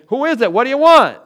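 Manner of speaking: loud, high-pitched demand; gruff challenge shouted. Retold or imitated. Householder shouts through the door at a late caller, loud challenge. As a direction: yell it at an unknown caller.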